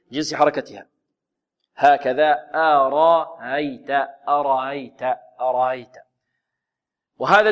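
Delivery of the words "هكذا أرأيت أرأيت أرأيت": second hamza eased toward alif in أرأيت, drawn out slowly; تسهيل الهمزة الثانية. Shown as a demonstration. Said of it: "بينها وبين الألف". (أرأيت بتسهيل الهمزة الثانية ببطء)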